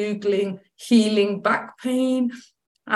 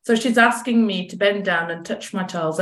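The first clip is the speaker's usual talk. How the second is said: normal talking voice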